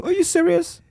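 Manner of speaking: high, disbelieving exclamation; incredulous. Retold or imitated. Owner venting about clients who skip paying, incredulous. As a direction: throw up your hands in disbelief. Outraged at clients skipping payment.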